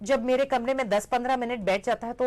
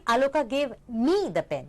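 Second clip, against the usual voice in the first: ME stretched and stressed, then falling; slow line that punches the word me; much slower than usual. Shown as a demonstration. Teacher showing given to nobody else, stress on me. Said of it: "I lay emphasis on different words".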